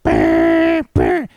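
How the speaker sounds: loud, high blaring alarm noise; imitated phone alert. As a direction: blare it out like an emergency alert. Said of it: "you just hear".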